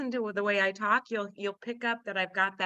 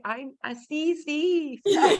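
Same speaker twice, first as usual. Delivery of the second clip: higher, laughing "see, see" like Spanish "sí"; 8 semitones higher, much slower than usual. Retold or imitated. Retelling her Spanish replies, higher and laughing. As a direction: say yes like a Spanish speaker, laughing. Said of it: "I say".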